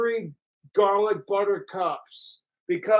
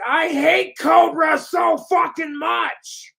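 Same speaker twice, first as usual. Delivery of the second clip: higher, louder, slow mock shout; slow mocking rage shout, much higher; mock troll rage; 8 semitones higher, 5 dB louder than usual. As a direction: ham up a furious hater's scream. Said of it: "They're like".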